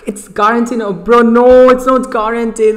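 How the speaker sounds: high loud dismayed outburst; frustrated protest. Real, not acted. Streamer protesting to chat about losing the guarantee. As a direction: protest the unfair pull.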